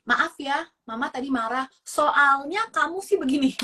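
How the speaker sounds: apology that turns into an accusation; blaming apology. Shown as a demonstration. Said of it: "maafnya gini nih".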